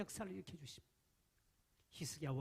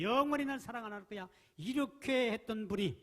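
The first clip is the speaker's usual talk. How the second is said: angry outburst; 7 semitones higher, 8 dB louder than usual. Acted. God's angry vow voiced.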